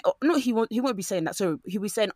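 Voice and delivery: ordinary talk, nothing marked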